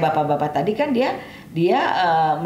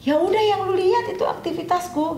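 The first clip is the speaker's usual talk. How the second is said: much higher, plain-spoken reply in character; high voiced gardener answering. (suara tinggi tukang kebun menjawab)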